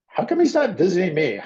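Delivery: imitating mom's high, hurt complaint; mock-upset mother's question. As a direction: protest at being left out, high-pitched. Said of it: "my mom would get a little upset".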